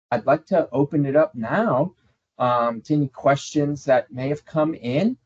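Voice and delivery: ordinary talk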